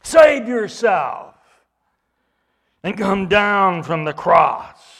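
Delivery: slow, loud jeering; mocking taunt. Crowd taunting Jesus on the cross, slow loud mockery. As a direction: drag out each taunt to rub it in. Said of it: "Almost like saying".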